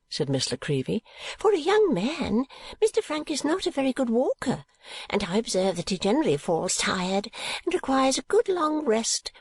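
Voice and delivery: high, sly little woman; teasing Miss La Creevy. A narrator's reading. Visitor hinting slyly to Kate, teasing. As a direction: tease in a high, chatty voice.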